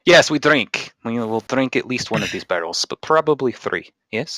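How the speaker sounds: put-on emphatic Russian-accented barkeep; hearty drinker with a Russian accent. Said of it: "the accent's just going away".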